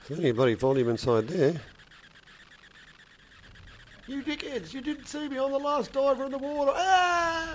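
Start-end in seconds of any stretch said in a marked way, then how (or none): 4.1-7.5 mock yelling sailor. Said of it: "Yelling down from the deck".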